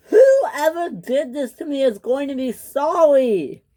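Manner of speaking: high, slow, indignant wail; angry wail. A narrator's reading. Clara threatens whoever hit her, angry wail. Hurt Clara vows revenge.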